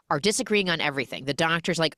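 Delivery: ordinary talk, nothing marked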